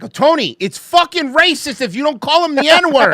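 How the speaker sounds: loud, high, hammed-up scolding; mock outraged yell. Egging Tony on to say the word, mock outraged yell. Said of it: "I'm like".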